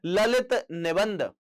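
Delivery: ललित निबंध drawn out slowly; slow emphatic repeat. Shown as a demonstration. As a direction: stretch Lalit निबंध out for students to note. Teacher repeats answer for emphasis. (Lalit निबंध धीरे-धीरे, ज़ोर देकर)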